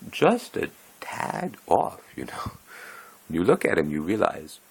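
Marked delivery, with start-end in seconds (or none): 0.1-2.0 slow, higher, each word stretched; drawn-out understatement. Shown as a demonstration. Teller drawing out a sly understatement about the odd guy. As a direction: savour the words like a coy euphemism. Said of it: "how shall we say".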